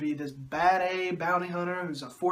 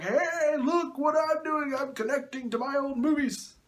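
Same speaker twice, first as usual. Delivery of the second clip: high-pitched, eager mock voice; goofy, high show-off caricature; George Lucas; 8 semitones higher than usual. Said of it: "George Lucas is like".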